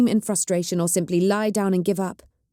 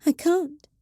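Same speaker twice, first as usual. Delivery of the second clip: small voice; 10 semitones higher than usual. A narrator's reading. The witch refusing to enter, high small voice. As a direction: barely get it out. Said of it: "in a small voice".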